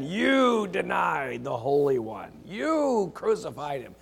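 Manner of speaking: slow, pointed accusation; slow stern charge against the crowd; accusing. Acted. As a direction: nail them with each word, slowly. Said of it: "Nails him to the wall".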